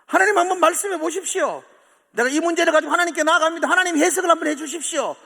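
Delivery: high, loud and fast crying out; urgent protest to God. Retold or imitated. Preacher voicing Job crying out to God, loud and fast. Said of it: "부르짖습니다".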